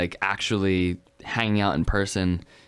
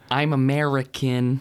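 mock American accent; 4 semitones higher, slower than usual. Joking about being the uncool one beside a Brit, mock American accent. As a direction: drawl out a hammed-up American accent. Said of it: "they don't say 'em quite like that".

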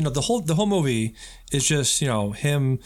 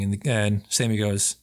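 ordinary talk, nothing marked

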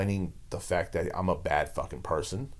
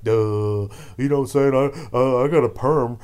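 louder, higher dopey mock voice; dumb anchor voice; 5 semitones higher, 8 dB louder than usual. Host mocks CNN anchors as brainless, loud dopey voice. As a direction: play a clueless newsreader.

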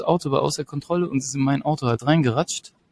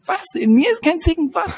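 baffled disbelief; 14 semitones higher than usual. Retold or imitated. Answers the officer's insult, baffled.

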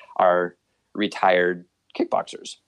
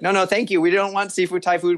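high, louder no thank you; firm refusal; 9 semitones higher, 3 dB louder than usual. Retold or imitated. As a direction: push back on the pitch. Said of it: "we're like".

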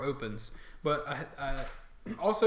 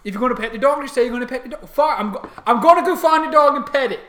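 loud, high orc squabbling; exasperated orc; 10 semitones higher, 15 dB louder, faster than usual. Acted. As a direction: argue at full volume as an orc guard. Orc guards squabble over petting dog.

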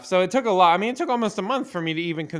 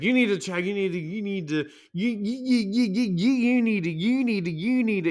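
higher, stuttering nag on repeat; mock nagging coach; 8 semitones higher than usual. Mocking the coach nagging him, high stuttered repeats. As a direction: imitate the coach stuck on a loop. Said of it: "She's like".

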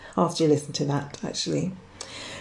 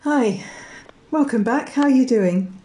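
ordinary delivery

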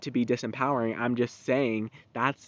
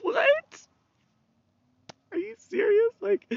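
very high squeaky "what?" with a laugh; mock disbelief; 5 dB louder, slower than usual. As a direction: shriek it like you can't believe it. Mocking disbelief at Brother Air.

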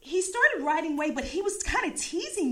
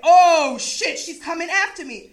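mock panic; 2 semitones higher, 9 dB louder than usual. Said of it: "He starts, like, freaking out".

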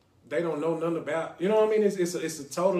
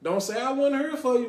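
higher and louder playful protest, teasing the listeners who did not call; mock dramatic complaint; 9 semitones higher, 6 dB louder than usual. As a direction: ham up the host's feeling of being abandoned. Host mock-complains as the show ends.

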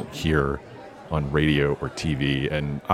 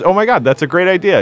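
excited thought; 13 semitones higher, 7 dB louder, faster than usual. Retold or imitated. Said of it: "my second thought was".

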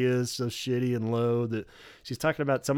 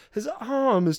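higher, baffled Robert voice; imitating Robert; 11 semitones higher than usual. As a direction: play Robert lost in her story. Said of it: "only do Robert".

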